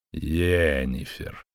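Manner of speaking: low, slow, sour mutter; displeased grumble. A narrator's reading. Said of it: "недовольно буркнул Доригарай".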